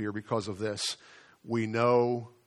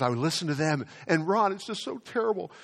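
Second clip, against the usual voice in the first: lightly voiced fretting mother, higher; a hint of his anxious mom, pitched up; worried mom; 6 semitones higher than usual. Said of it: "and she says".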